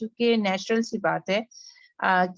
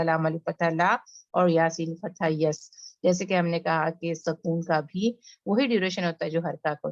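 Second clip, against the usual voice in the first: normal talking voice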